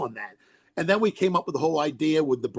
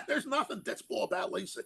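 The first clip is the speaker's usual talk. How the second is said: laughing; 10 semitones higher than usual. Real, not acted.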